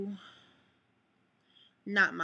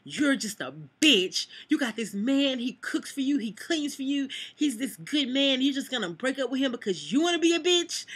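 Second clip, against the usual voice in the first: high, loud scolding rant; cussing her out; 7 semitones higher, 8 dB louder than usual. Retold or imitated. Coworker cussing her out over the breakup, in a high, loud scolding rant. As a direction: tear into her like an outraged friend. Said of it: "Here's this chick cussing me out".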